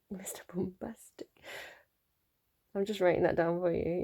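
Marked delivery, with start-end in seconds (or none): 0.1-1.3 a soft breathy whisper through a laugh. Real, not acted. Reader whispering the song title to herself, amused whisper.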